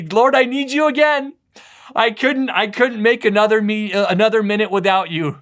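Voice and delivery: high, loud cry out to God; desperate plea. Acted. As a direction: plead as a needy believer falling on their knees. Acted plea of needy believer praying.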